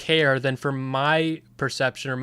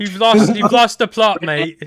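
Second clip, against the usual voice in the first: loud, high British "you've lost the plot, mate"; hammed-up British banter, pitched up and loud; mock British accent; 6 semitones higher, 7 dB louder than usual. Teasing a friend in mock British slang, loud British accent. Mocking British Twitter talk.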